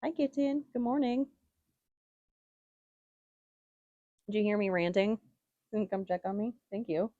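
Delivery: high-pitched sweet pet talk; talking to a kitten. Real, not acted. Cooing to her pet kitten.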